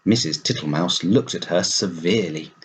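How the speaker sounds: usual speaking voice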